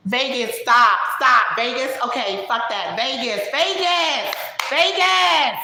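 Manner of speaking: high, loud interrupting caricature building to a shout; Jessica. Acted. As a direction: break in and yell to shut a friend up. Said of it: "that was Jessica breaking in".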